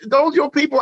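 pitched well up, a curious stranger asking; odd stranger's voice. Retold or imitated. A homeless man asking the visitor about the crime scene, in a high voice.

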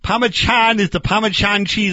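Parmesan said confidently as "pah-ma-chahn"; mangled Italian pronunciation. Acted. Barbecue man claiming it's Italian, confidently mispronounced. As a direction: put a CH in Parmesan and drop the R. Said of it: "not the way they say it in Italy".

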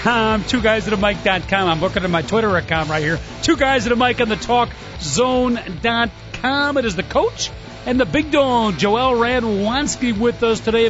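upbeat radio host show opener; sports talk host. Acted.

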